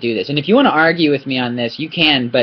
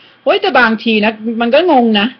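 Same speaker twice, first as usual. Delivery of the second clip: candid Thai self-assessment; 7 semitones higher, much faster than usual. Retold or imitated. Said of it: "they say".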